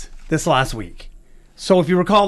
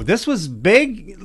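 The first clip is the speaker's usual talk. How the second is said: ordinary talk, nothing marked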